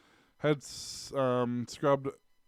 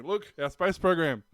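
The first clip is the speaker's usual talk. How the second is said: mock proud local; 6 semitones higher, 6 dB louder, slower than usual. Imagined locals showing off wheelie bin.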